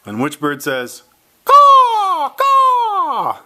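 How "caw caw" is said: very high, loud harsh caws; loud playful crow call for the child. Shown as a demonstration. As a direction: screech out caw like a crow. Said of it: "I'll do the sound".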